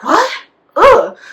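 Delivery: high, loud recoil of revulsion; mock disgust. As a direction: imitate her appalled reaction. Retold mean girl's disgusted reaction.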